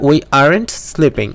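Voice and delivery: very slow, word by word; slow model sentence. Shown as a demonstration. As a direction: spell it out for the class. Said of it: "model sentence drill". (খুব ধীরে, প্রতিটি শব্দ আলাদা করে)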